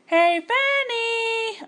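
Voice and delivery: long high sung-out call. Acted. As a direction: call her name across the garden. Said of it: "a voice called".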